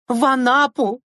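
very high, stretched-out place names; exasperated emphasis. A narrator's reading. Teacher stressing where the class is going, exasperated. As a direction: spell out the grand destination, indignant.

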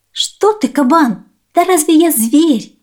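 высокий голосок хитрой мыши; тонко, с притворным удивлением; голос летучей мыши. Acted. Мышь отвечает кабану. (высокий удивлённый голос мыши)

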